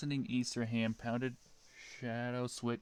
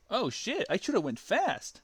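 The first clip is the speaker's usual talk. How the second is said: high, loud, yelping hero voice; Sonic voice; 10 semitones higher, 6 dB louder than usual. Acted. Sonic yelping after Shadow punches him. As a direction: yelp it out as the punched hedgehog. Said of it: "said Sonic".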